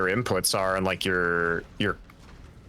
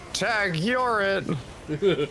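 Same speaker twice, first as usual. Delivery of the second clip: teasing; 7 semitones higher, much slower than usual. Pretend. After stabbing enemy.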